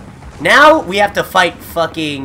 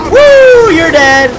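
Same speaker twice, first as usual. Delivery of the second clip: high, loud victory shout; triumphant whoop; 13 semitones higher, 11 dB louder, much slower than usual. Real, not acted. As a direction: whoop and crow over the kill. Cheering a kill in game.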